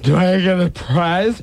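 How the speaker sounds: put-on slow, high, pleased drunk; smiling drunk. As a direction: ask with a drunken grin.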